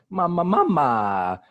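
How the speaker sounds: high, loud, drawn-out villain call; villain's cackle-cry. Acted. Recapper performing Big Mom's signature laugh, hammed-up villain. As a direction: gloat in a slow, high mock cackle.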